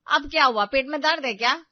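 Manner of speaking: loud, exasperated questioning; fed-up elder sister-in-law, loud; irritated. A narrator's reading. Annoyed sister-in-law snaps at Rita.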